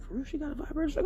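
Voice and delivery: high, hushed disbelieving driver; high and soft, hardly believing it; bus driver asking. Retold or imitated. Bus driver quietly checking the story, high and soft. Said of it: "He was like".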